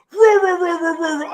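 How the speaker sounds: 高声乱嚷的嘲弄式叫喊，调子明显拔高; 把对方的气急败坏学成一串夸张的吼叫; mock angry ranting. Mocking the clerks' angry outburst.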